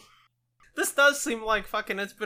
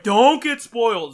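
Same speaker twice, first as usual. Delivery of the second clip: mock warning; 5 semitones higher, 14 dB louder, slower than usual. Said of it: "you're like".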